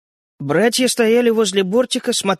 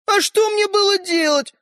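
high pitched exclamation; exclaiming; 14 semitones higher, 3 dB louder than usual. A narrator's reading. The little man to the brothers, exclaiming high. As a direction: throw the question out in exasperation. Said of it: "воскликнул мальчик с пальчик".

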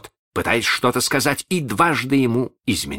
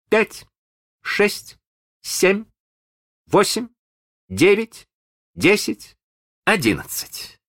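put-on high, steady child counting; measured counting; 13 semitones higher, slower than usual. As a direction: count evenly like a small boy. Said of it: "мерный голос малыша". (мерный детский счёт)

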